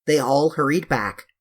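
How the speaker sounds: ordinary delivery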